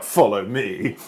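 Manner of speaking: ordinary talk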